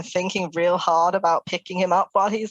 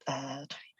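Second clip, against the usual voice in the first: whispered; 5 semitones lower, 17 dB quieter than usual. Real, not acted. Player reading dice.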